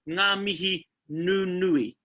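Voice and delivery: "ngā mihi nunui" said slowly and clearly; drawn out "ngā mihi nunui" for learners; teaching the phrase slowly; slow pronunciation. Shown as a demonstration. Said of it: "a pro tip".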